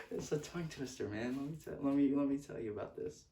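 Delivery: amused outburst at a real fumble; laughing. Real, not acted. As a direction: laugh it off after tripping on your words. Laughing after fumbling words.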